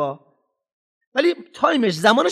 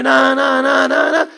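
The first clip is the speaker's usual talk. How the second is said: put-on loud, higher childish no no no; whining child protest; 5 semitones higher, 7 dB louder, slower than usual. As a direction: imitate a child refusing loudly. (اعتراض بلند و بچگانه)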